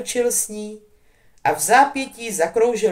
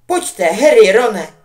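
1 calling out; 2 semitones higher, 10 dB louder, slower than usual. A narrator's reading. Hermione calls the boys away.

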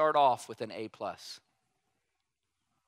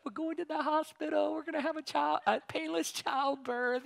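put-on giddy high-pitched wife, much higher, slowed on painless childbirth; giggly excited wife heading to the hospital; playing his giddy wife; laughing wife; 12 semitones higher, 4 dB quieter than usual. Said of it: "she's all laughing".

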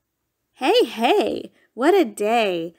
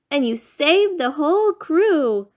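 ordinary delivery, nothing marked

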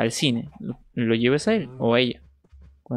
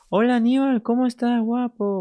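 gushing greeting; 10 semitones higher, 3 dB louder than usual. Real, not acted. Streamer greets viewer in chat.

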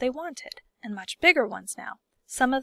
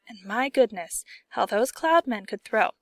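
usual speaking voice

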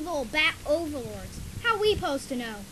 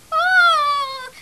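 long, loud, high scream; 12 semitones higher, 11 dB louder than usual. Acted. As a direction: scream as they come after you. Attacked by what is coming.

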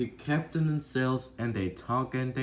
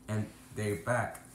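ordinary delivery

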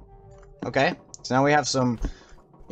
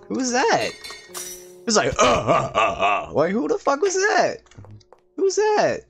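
startled; 10 semitones higher than usual. Real, not acted. Startled by a strange sound.

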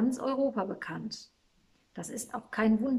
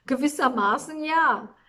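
amused; 5 semitones higher, 7 dB louder than usual. A narrator's reading. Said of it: "Der Engel lachte".

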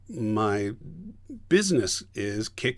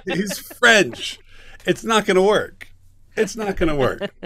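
high, loud, laughing exasperation; exasperated; 7 semitones higher, 6 dB louder, slower than usual. Retold or imitated. Director reports the failed audition to the casting director, exasperated. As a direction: hammer it home in comic despair.

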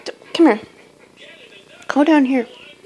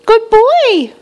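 put-on much higher good-boy coo, falling at the end; pet praise baby talk; 13 semitones higher, 5 dB louder than usual. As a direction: praise the bird in a high sing-song. Praising bird again.

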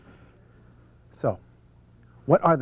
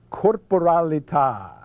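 Italian accent; 3 semitones higher, 3 dB louder than usual. Shown as a demonstration. Speaker presenting a principle, showy Italian accent. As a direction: roll out every syllable in an Italian accent. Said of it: "with your best Italian accent".

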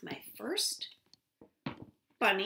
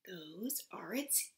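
whisper; 4 semitones lower, 7 dB quieter, much slower than usual. Acted. Secretive aside in story.

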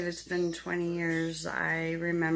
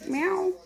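cat meow; 10 semitones higher, 3 dB louder than usual. Retold or imitated. Meowing at kittens.

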